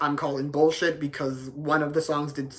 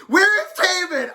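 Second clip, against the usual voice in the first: shouting; 12 semitones higher than usual. Real, not acted. Ranting to viewers about Taemin's snub, shouting. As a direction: yell it in disbelief, full voice. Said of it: "I was shouting".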